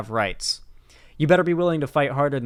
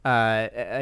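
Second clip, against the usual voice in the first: normal talking voice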